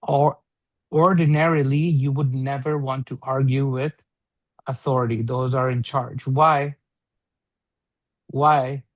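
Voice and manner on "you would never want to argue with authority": usual speaking voice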